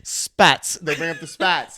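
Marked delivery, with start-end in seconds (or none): 0.0-0.7 louder, punchy one-word order; loud mock demand. Ordering spats from staff, louder mock command. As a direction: bark it like a demand.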